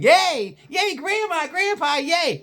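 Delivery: put-on high excited child cheering; high giddy kid yay; bouncing kid cheer. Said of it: "doing one of these bouncing".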